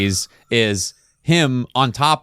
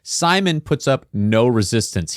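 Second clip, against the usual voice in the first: normal talking voice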